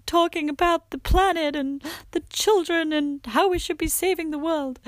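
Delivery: high, overwrought mock-emotional voice; tipsy emotional. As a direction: imitate yourself getting weepily earnest when tipsy. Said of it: "my way of getting emotional".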